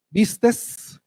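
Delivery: "Vistes" con una S de más al final; S costeña añadida. Shown as a demonstration. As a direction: remata "Vistes" alargando la S final sobrante. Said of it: "la ponen donde no debe estar". ("Vistes" a lo costeño, con S final marcada)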